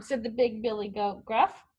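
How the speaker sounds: ordinary delivery, nothing marked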